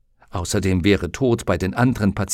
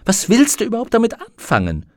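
exasperated; 11 semitones higher, 4 dB louder, faster than usual. Acted. Exasperated question to raven.

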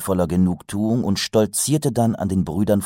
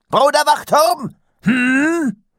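high, loud stern call; booming demand; 7 dB louder, slower than usual. A narrator's reading. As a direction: demand an answer at once. Master demands an answer.

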